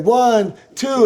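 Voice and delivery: high, rising count; referee count. Retold or imitated. Wrestler retelling the near fall, a rising high referee count. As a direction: count it out over the roaring crowd.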